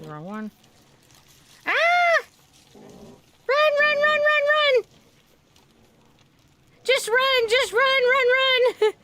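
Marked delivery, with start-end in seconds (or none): 1.7-2.2 sudden high yelp of alarm; panicked scream. Real, not acted. 2.7-8.9 high, loud panicked run calls; terrified horror victim. Real, not acted. Fleeing monsters in game.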